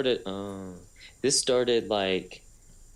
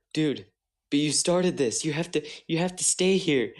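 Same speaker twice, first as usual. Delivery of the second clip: imitating a friend, pitched higher; higher mock-whiny protest to stay; mock pleading friend; 7 semitones higher than usual. Friends protest him leaving the chat.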